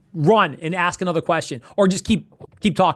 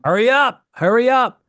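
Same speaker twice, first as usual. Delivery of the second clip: higher-pitched, insistent nagging; impatient urging; 4 semitones higher, 4 dB louder than usual. Acted. Coach voicing an impatient customer to Kevin, insistent urging. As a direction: push each repeat like a customer losing patience. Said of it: "their patience level, like, in an instant becomes".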